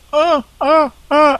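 high far falsetto screams imitating Alf; mock scream. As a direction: yelp it high and short. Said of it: "you could have the screams".

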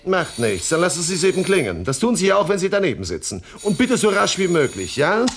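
high, loud, brisk order; loud, pressing command to staff; bossy impatience. Acted. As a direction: overrule the secretary impatiently. Boss orders secretary about coffee.

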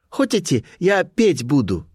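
put-on high girlish offer; Colibri's voice. A narrator's reading. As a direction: ask in a high character voice, stretching the second phrase. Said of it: "торопливо спросила она".

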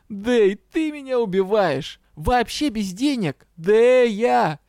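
high, slow wail of reproach; appalled outcry. A narrator's reading. Money-minded partner hears she works unpaid, anguished outrage. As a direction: cry out, dragging words in despair.

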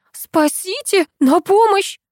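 high, frightened call for help; panicked cry for help. A narrator's reading. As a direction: shout for rescue in panic. Heroine screams for help.

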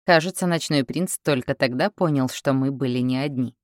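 plain reading voice

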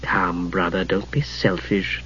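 soft, slowed taunt; mocking tone. A narrator's reading. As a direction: needle him with quiet mockery. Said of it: "her tone mocking".

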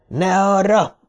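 high-pitched urgent warning; contained shout. A narrator's reading. Dick warns Trev off the wrong way, shouting. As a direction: cry out a warning at moderate volume. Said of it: "ordította".

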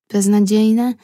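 plain reading voice

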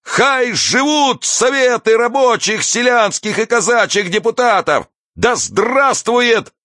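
high-pitched rallying cry; orator's high exclamation; crying out slogans. A narrator's reading. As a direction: proclaim it to the crowd, stretching the words. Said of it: "вскрикивал".

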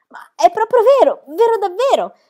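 can hardly believe the good news; overjoyed disbelief. A narrator's reading. Tommy asking Pippi if it's true, overjoyed.

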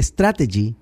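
strategy as "STRA-te-ji"; correct English pronunciation. Shown as a demonstration. Commentator naming the intended word, strategy pronounced "STRA-te-ji". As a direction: stress the first syllable of strategy, "STRA-te-ji". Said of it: "La palabra es strategy".

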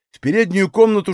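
ordinary delivery, nothing marked

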